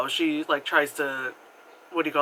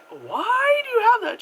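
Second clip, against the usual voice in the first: incredulous; 5 dB louder than usual. Retold or imitated. Therapist asking in disbelief.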